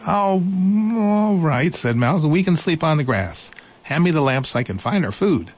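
high, kind mouse voice; reassuring little mouse. Acted. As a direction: comfort him in a high little voice.

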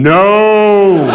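long, high, loud mock scream. Comedian imitating ex-girlfriend in bed, mock screaming. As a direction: stretch it out into a hammed-up cry.

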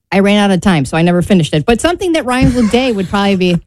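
usual speaking voice

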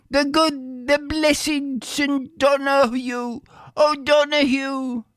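high, sustained calling voice. A narrator's reading. Grateful peasant calling after the rider on the lake, calling out. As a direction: hail him from afar, high and held. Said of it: "shouted Bill".

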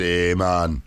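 lightly put-on Jamaican "yeah, man"; a hint of Jamaican drawl on the man; Jamaican accent. Host teasing a colleague off to Jamaica, mild Jamaican accent. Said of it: "coming back speaking like this".

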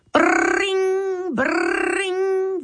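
high, ringing brrring like a telephone; imitated phone ring. A narrator's reading. Narrator imitates the family phone ringing, high and ringing. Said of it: "the phone was ringing".

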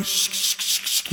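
an unvoiced shushing hiss, short and repeated. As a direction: hush the new believer like a scold. Legalists hushing a new believer.

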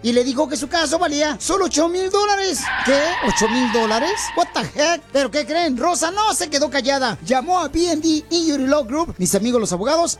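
high-pitched, punchy commercial pitch; radio ad read. Acted. Radio host pitches injury lawyers to listeners, high-energy ad read. Piolín reads a lawyer advertisement.

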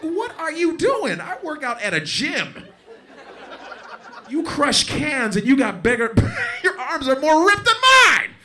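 high-pitched exasperated protest; mock indignation. Gym-goer confronting a ripped can crusher, indignant. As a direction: build from high complaint to loud screech. Comic outrage at ripped homeless man.

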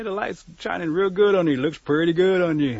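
a touch of showy enthusiasm; mildly gushing flattery. Acted. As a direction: lay on the compliment a little. Turtle acts out admiring turkey.

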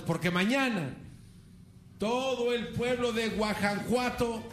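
high, slow pompous orator; high slow mock proclamation; mock Hidalgo speech. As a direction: proclaim like a hammed-up hero. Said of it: "se pone a gritar".

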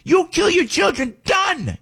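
a parent's outrage bursting out; angry shout. Real, not acted. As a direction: yell it in fury, then cut it off. Angry rant about child killers.

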